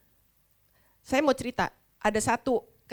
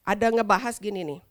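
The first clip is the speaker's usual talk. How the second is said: ordinary talk, nothing marked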